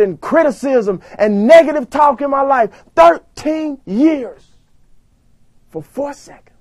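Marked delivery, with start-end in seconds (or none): 5.7-6.5 "for four seconds" whispered much softer; hushed whisper. Shown as a demonstration.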